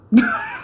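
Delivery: high, squealing laugh; high burst of laughter. Real, not acted. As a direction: crack up at the line.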